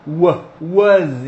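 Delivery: "was" with short UH vowel and buzzing Z; nicer "was". Shown as a demonstration. Teacher showing a prettier pronunciation, "was" as "wuhz". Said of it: "Pra deixar um pouquinho mais bonito".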